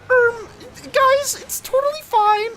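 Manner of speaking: mock high voice. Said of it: "the family say something like".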